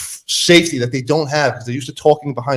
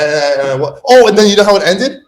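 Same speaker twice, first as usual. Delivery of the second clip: normal talking voice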